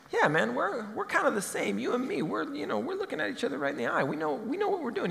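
high, soft, chummy insider voice; high-pitched conspiratorial chumminess; fake commiserating. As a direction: play it like a buddy sharing a secret. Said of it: "He likes to talk as if he's commiserating".

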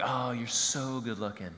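soft, slow buttering-up; mock flattery, slowed and soft; flattering. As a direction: lay the flattery on gently. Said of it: "somebody butters you up".

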